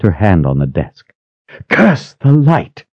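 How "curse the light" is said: high slow cursing; furious curse at news; angry curse. A narrator's reading. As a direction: spit the curse out slowly. Sister curses in rage.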